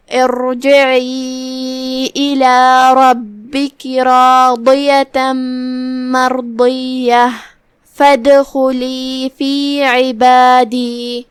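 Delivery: slow melodic Quran chant, long drawn vowels; tajweed recitation. Shown as a demonstration. Teacher recites the verses for the class, slow melodic chant. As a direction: recite each phrase slowly, stretching the madd.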